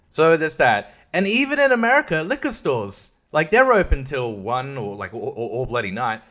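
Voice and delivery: ordinary delivery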